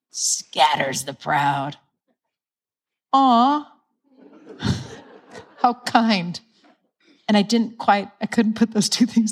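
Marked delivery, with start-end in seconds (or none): 0.1-1.8 tender awe. Retold or imitated. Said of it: "he's so kind". 3.1-6.4 tender aw. Retold or imitated. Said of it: "he's so kind".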